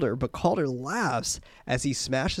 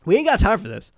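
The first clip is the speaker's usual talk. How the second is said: impatient; 5 semitones higher, 6 dB louder, faster than usual. Retold or imitated. Voiced character, impatient.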